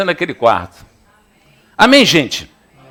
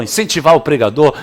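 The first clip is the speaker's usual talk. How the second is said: ordinary talk, nothing marked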